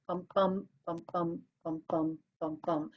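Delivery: "bum, bum" in pairs, weak then strong; iambic heartbeat. Shown as a demonstration. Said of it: "referred to as a heartbeat".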